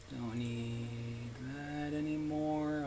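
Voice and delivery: very slow, soft, stretched vowels; drawn-out singsong. Real, not acted. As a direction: stretch every word out long and softly. Second take stretched out slowly.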